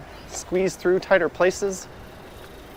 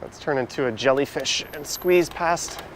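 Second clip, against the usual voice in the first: usual speaking voice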